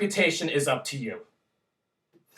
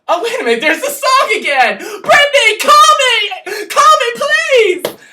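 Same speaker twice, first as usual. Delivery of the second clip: much higher and louder, crying out; hammed-up fan wail; 11 dB louder than usual. Fan pleading with [name] to call him, shrieking.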